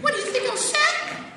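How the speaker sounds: high, loud, shrieking mock wife; offended wife. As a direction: snap back as the insulted wife. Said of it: "If you say".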